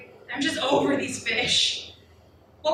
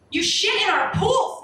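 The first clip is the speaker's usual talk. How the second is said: a sharp snap, louder and higher; snapped; 6 semitones higher, 3 dB louder than usual. Acted. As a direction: snap the accusation out. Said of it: "snapped Kevin".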